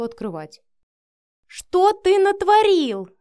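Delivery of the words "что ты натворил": loud, high, drawn-out shriek; outraged scream. A narrator's reading. Mother bursting into her son's flat, screaming in outrage. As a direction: scream it at him, slow and high. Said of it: "заорала Лидия".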